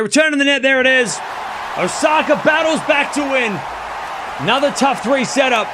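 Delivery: high, excited match-point call; live play-by-play. Real, not acted.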